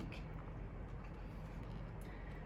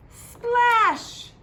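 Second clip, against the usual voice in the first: splash sound; 10 dB louder than usual. Acted. Frog leaps into pond.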